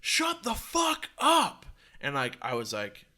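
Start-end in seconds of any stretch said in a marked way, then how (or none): 0.0-1.6 high, slow, stretched-out holler; imitated yelling. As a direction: holler it like a fed-up husband next door. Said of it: "if he was yelling at me".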